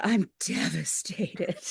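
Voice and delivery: soft, slow, quietly crushed; imitating heartbroken. Retelling the swimmer telling her crew, softly heartbroken. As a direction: let it sink, a gentle imitation of despair. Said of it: "she just sounded so heartbroken".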